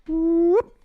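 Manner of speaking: high, loud whooping slide; playful high-pitched sound effect; imitated upward whoop. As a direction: whoop like a cartoon slide whistle. Imitates ranking going up.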